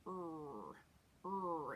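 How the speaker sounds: hard growled "Er", held long; growly R. Shown as a demonstration. Teacher has everyone try the Midwestern R, growly R. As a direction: say "Er" with a strong, twangy growling R. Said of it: "like you're growling at somebody".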